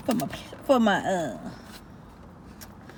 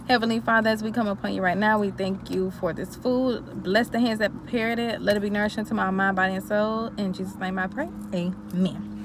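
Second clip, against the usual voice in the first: prayer; 5 dB quieter than usual. Real, not acted. Blessing the food.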